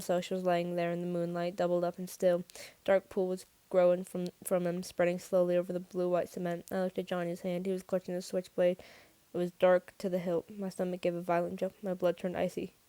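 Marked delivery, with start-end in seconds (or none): none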